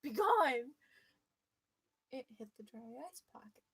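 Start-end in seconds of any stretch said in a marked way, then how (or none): 0.0-0.7 loud, high booming "Be gone!"; mock exorcist shouting; mock priest exorcism. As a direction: cast out the demon like a hammy priest. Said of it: "just like".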